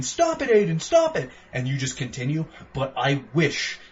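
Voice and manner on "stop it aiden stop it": higher pleading shout of stop it; imitating Jodie screaming at Aiden, pitched up; retold scream. Retelling the game to his co-host, mock high scream. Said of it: "Jodie screaming at me saying".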